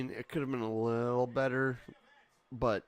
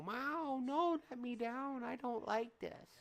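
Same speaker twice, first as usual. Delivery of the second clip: high, squeaky voice of the cat protesting; 13 semitones higher, 3 dB quieter than usual. Pretend. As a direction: voice the cat whining to be put down. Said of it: "he's gonna do his cat yelp and go".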